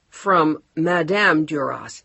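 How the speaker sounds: measured report from the housekeeper. Acted.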